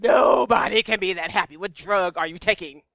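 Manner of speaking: loud, incredulous outburst at normal pitch; mock disbelief. Retelling a reply to the too-happy friend, loud mock disbelief. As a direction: burst out at an impossibly cheerful friend. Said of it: "And I'm like".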